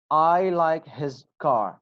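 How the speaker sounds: slow, word by word; slow careful reading. Shown as a demonstration. Teacher reading the example slowly to students. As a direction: read it out slowly for the class. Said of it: "I'll read the examples one more time".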